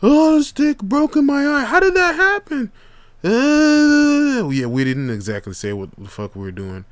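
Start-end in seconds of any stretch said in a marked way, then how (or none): 0.0-1.6 much higher and louder, hurt; whiny kid excuse. Retold or imitated. Injured brother telling mom, high and loud. 1.6-4.5 much higher and louder, panicked; shrill mom demanding answers; alarmed mom. Retold or imitated. Mother asking kids about the injury, high alarmed.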